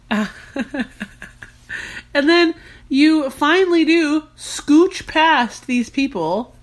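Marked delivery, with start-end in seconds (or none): 0.0-0.6 soft breathy laughing; little private giggles; quiet laughter. Real, not acted. Laughing at the cat.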